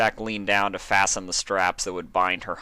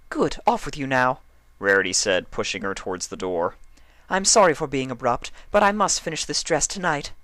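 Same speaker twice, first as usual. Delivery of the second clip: high, refined lady voice; prim, fussy fashion pony; Rarity voice; 7 semitones higher, 3 dB louder than usual. A narrator's reading.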